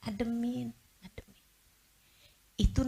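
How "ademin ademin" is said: softer each time until a whisper; calm it down, fading out; soothing fade to silence. Shown as a demonstration. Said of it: "kalau ada yang lagi marah".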